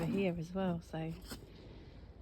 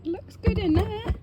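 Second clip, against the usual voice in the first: excited; 9 dB louder than usual. Real, not acted. Pleased look inside compost bin.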